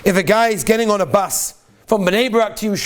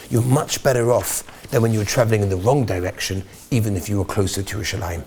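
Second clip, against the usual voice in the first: ordinary talk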